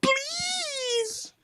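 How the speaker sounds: high, whimpering fake sob; mock crying. Retelling a man begging his girlfriend on the phone, mock crying. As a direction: imitate a dumped man pleading in tears. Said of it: "crying. He's like".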